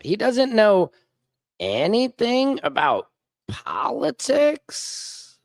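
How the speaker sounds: exaggerated incredulous repeat; mock disbelief. Real, not acted. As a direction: stretch politics out in scornful disbelief. Repeats it mockingly, drawn out.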